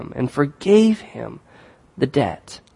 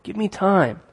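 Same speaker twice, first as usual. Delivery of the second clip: pleading voice rising higher; desperate servant begging for more time; a mild acted plea, pitched up; 6 semitones higher than usual. A narrator's reading. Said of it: "he begs".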